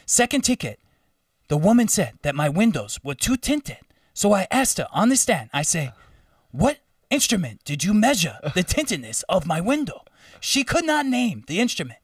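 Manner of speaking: high, theatrical African driver impression; imitated African accent, rising to a triumphant verdict. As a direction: argue the case grandly in a put-on African accent. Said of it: "I'm gonna do the accent".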